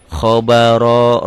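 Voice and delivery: Khobaro said slowly with a heavy ro; Arabic example word. Shown as a demonstration. Teacher reciting Khobaro for the class, slow. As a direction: draw out Khobaro with a thick ro. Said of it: "ro-nya baris di atas".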